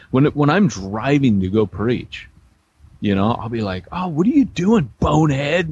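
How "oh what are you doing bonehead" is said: higher-pitched mock driver's gripe; pitched-up, half-serious road rage. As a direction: complain at a bad driver, playfully exasperated. Retold yelling at drivers.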